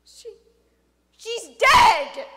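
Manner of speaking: halting start, then very loud cry; falter, then burst out in grief; anguished outburst. Acted. Father telling of daughter's death, anguished.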